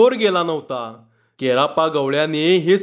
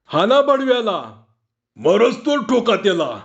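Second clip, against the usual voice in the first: higher, rough eager call to beat him; urging a beating; 6 semitones higher than usual. Acted. Villager urging the crowd to beat the thief, raised fierce call. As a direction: egg the crowd on against the thief.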